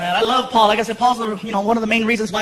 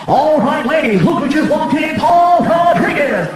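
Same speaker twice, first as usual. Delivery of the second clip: loud, raised DJ hype, drawing out the name; booming mock introduction, last name stretched slow; club DJ announcer; 5 semitones higher, 6 dB louder, slower than usual. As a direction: imitate a strip-club announcer introducing a star. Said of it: "the D J's like".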